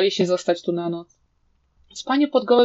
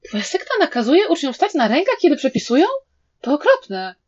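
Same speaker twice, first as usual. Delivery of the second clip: high-pitched shocked question, swooping up; 6 semitones higher than usual. A narrator's reading. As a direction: react in appalled disbelief. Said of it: "Wei Wuxian też był zszokowany". (zszokowane pytanie, wysokim głosem)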